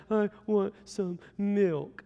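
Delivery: put-on slow, halting toddler whimper; whiny little daughter. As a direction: act out a small child whining a request. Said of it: "And finally, she's like".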